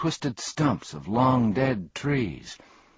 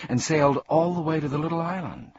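usual speaking voice

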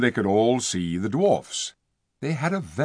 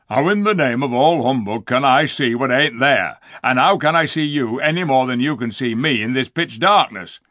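scornful dwarf; 3 semitones higher, 6 dB louder than usual. Acted. Diggle scolding Edmund about the dark stable, loud and scornful. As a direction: spit out the insults.

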